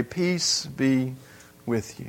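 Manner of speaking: tender Jesus voice. Acted. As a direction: speak it gently like a comforting blessing. Jesus's comforting words, softly quoted.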